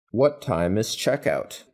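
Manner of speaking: ordinary delivery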